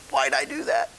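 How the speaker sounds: high-pitched mock self-reproach; regretful child. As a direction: kick yourself in a small high voice. Voices himself as regretful child.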